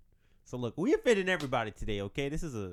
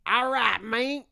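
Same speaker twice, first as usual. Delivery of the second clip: put-on Southern doctor accent; 9 semitones higher, 6 dB louder than usual. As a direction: play a Southern country doctor, hammed up. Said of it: "Southern accent doing the doctor".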